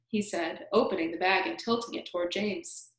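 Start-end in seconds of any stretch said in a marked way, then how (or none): none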